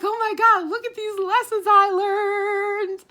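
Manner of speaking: very loud, high squeal, last word held long; acted-out ecstatic outburst; excited. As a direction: shriek it with overjoyed pride. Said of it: "I'm so excited right now".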